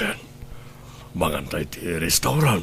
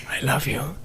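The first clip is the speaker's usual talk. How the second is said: bedtime whisper; 6 semitones higher, 3 dB quieter, slower than usual. Acted. Couple whispering goodnight in bed.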